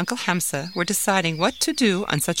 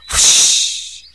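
a loud, breathy swoosh of air; loud whoosh; 10 dB louder than usual. Acted. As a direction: hiss out the swing of the bat. Bat swinging sound.

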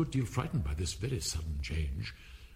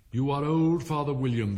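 raised, metrical opening line; poem recitation; 3 semitones higher, 6 dB louder than usual. A narrator's reading. Alice beginning her recitation, raised and rhythmic. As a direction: launch the verse in rhythm, without drawling.

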